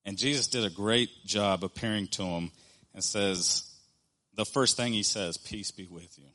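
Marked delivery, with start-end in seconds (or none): 5.4-6.3 softly, without blame, a hushed peace; gentle greeting. Retold or imitated. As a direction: offer peace quietly, no condemnation. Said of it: "He doesn't condemn Thomas".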